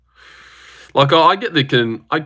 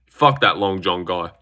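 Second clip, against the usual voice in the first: normal talking voice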